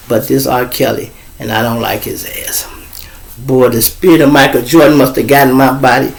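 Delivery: usual speaking voice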